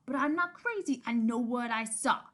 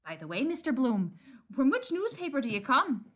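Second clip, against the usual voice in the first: usual speaking voice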